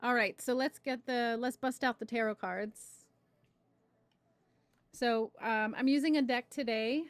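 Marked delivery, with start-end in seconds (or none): none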